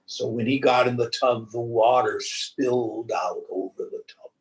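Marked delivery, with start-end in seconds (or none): none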